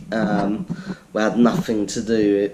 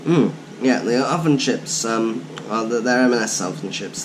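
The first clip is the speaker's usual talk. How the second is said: usual speaking voice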